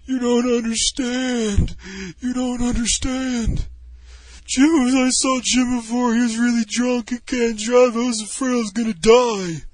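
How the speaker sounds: high, loud, drawn-out mock wailing; hammed-up tearful pleading; imitated drunken crying. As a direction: bawl it out like an emotional drunk. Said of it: "He starts crying and shit".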